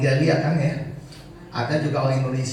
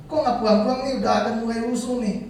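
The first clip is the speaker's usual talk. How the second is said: high-pitched, urgent voice of a wife on the phone; imitated anxious wife; 8 semitones higher, 3 dB louder, faster than usual. Wife phoning husband as riots begin, imitated high anxious urging. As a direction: imitate a wife's insistent question, pitched up.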